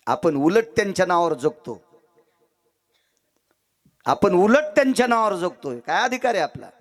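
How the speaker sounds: high-pitched rhetorical challenge; impassioned preaching. Acted. Preacher asking listeners what worth they have, impassioned. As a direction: press each question on the crowd.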